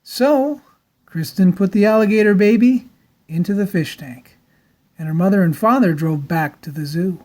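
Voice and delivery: usual speaking voice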